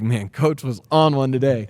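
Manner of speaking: tired player venting to a parent, lightly voiced; kid griping about coach. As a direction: play the kid whining about a tough practice. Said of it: "come home and be like".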